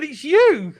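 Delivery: mock triumphant friend voice; pal's proud reveal. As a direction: announce it like a proud gift-giver. Voices the school pal proudly.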